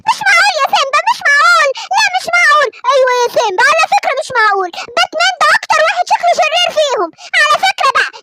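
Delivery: a mum who can't believe it; doubtful mother. Acted.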